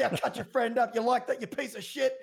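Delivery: imitating an in-game yell, laughing through it; mock yelling, laughing. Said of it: "while you're screaming at someone to".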